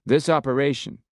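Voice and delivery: a lightly voiced Texan officer taking charge; Texan commander. A narrator's reading. Miller briefing the special ops team, brisk Texan voice.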